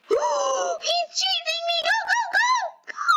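high panicked shouting; fleeing in a frantic yell; being chased. Real, not acted. Fleeing the chasing monster.

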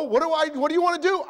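high, fast frustrated wife; wife giving up on her grumpy husband, high and fast; exasperated wife. Retold or imitated. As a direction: blurt it out at the end of her rope. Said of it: "your wife says".

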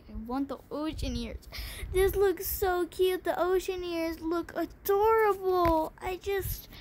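usual speaking voice

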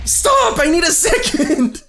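high, loud yell for a break; panicked shout. Real, not acted. Player begging attackers for a second, panicked shout. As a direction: shout it out, frantic for breathing room.

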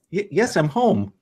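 put-on stammer, then play the child; hesitant playing along. [name] humors Serena's homecoming greeting, hesitantly playing along.

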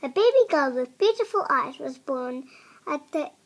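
ordinary delivery, nothing marked

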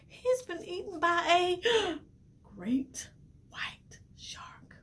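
slow hushed whisper with a gasp; scary whisper. Acted. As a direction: whisper it to spook the kids. Scary reveal for children.

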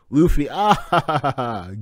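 delighted laughter; laughing; real laughing. Real, not acted. Laughing at the panel.

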